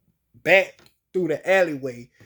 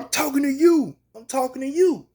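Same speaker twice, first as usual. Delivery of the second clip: high, loud, insistent; mock aggressive demand; 10 semitones higher, 4 dB louder than usual. Gangster demands the boy's attention, retold angry call. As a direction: demand he look over here.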